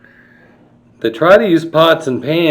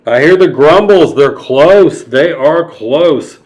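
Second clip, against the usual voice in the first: loud, higher alarmed warning; 3 semitones higher, 7 dB louder than usual. Acted. Storyteller frightening the child: loud alarmed warning. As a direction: sound the alarm, slowing on close. Scared whisper turned loud.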